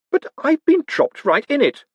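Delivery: put-on high, outraged young man; blurting a defence; indignant protest. Moist protests.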